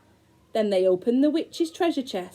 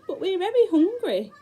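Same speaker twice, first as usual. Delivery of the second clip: put-on high, a little louder boy voice; Hansel's voice; 11 semitones higher, 3 dB louder than usual. Hansel apologising to the old woman in a high boy voice. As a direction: pipe up like a sorry little boy.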